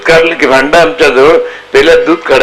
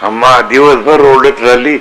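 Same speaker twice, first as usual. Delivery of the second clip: usual speaking voice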